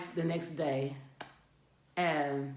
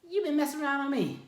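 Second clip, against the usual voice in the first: angry accusation; 8 semitones higher, 4 dB louder, faster than usual. Retold or imitated. Confronting cheating husband with knife.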